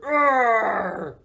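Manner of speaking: long, high storybook roar. A narrator's reading. Boris about to chase Morris, roaring. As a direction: let out a drawn-out animal roar. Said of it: "Boris roared".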